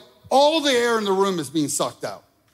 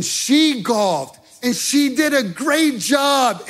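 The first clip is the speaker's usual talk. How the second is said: excited shout; 7 semitones higher, 5 dB louder, slower than usual. Real, not acted. Bragging about wife's golf.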